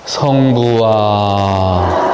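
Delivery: very slow, drawn-out invocation; prayer intonation. Shown as a demonstration. Speaker showing how to hush a noisy crowd, long drawn-out prayer opening. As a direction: stretch each syllable of 성부와 like an opening prayer. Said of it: "어떻게 할까요?".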